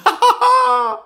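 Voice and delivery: very high, loud elated laugh; splendid laugh. A narrator's reading. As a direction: burst into a ringing laugh. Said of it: "it was a splendid laugh".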